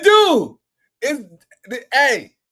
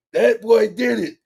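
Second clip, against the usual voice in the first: usual speaking voice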